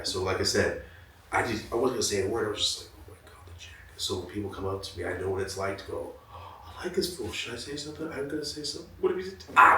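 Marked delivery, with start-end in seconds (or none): 3.0-3.7 barely audible awed whisper; hushed acted fan gasp; starstruck whisper. Retells starstruck silent reaction. 6.3-9.8 acted starstruck self-talk; nervous fan dithering. Acts shy fan working up courage.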